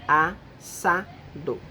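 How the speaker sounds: slow, split into syllables: as-sa-do. Shown as a demonstration. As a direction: say Assado slowly, pausing between each syllable. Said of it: "Três partes". (dividindo Assado em sílabas, bem devagar)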